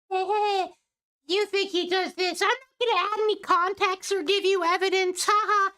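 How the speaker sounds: high, mocking snobbish voice. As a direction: ham up a smug know-it-all. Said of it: "obnoxious fucking dumb bitches".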